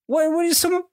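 very high, exasperated squeak; comic high-pitched pleading; mock exasperation. Exasperated appeal to fussing baby.

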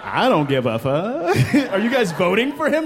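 ordinary talk, nothing marked

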